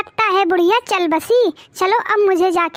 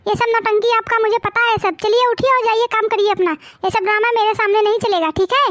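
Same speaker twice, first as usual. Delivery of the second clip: ordinary talk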